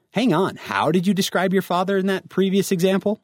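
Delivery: normal talking voice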